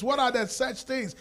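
normal talking voice

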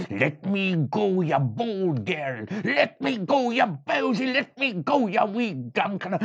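a furious little creature begging; pleading leprechaun. Acted. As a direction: caught leprechaun scolding the girl.

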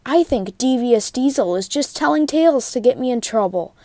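ordinary delivery

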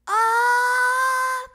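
a huge sustained high "Ah!"; long loud ah. A narrator's reading. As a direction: open wide and belt it out. Froggy opens wide saying ah.

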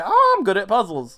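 puzzle braggart. Acted. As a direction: lightly put on a show-off. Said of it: "I'm gonna be that guy".

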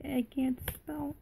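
unvoiced whispered aside; whispering. Real, not acted. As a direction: whisper it to yourself. Whispered aside while playing.

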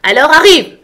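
high, very loud command; full-voiced queen's roar. A narrator's reading. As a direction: yell at them to get moving. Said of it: "hurla la reine".

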